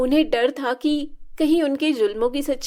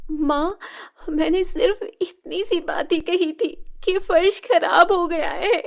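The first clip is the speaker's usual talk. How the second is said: put-on higher, near-crying girl's voice; tearful plea; 7 semitones higher than usual. Shilpa pleading with her stepmother after a slap, tearful. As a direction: plead through tears.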